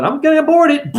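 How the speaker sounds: high, loud, rushed mimicry; mocking imitation. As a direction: flippant squeaky put-on quote. Mimics women making a careless choice.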